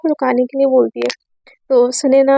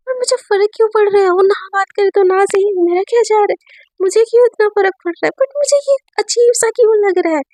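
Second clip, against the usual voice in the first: Sunaina's inner voice; 6 semitones higher than usual. Acted. Girl asking herself why she even cares, agitated and higher. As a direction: voice her agitated thoughts, pitched up.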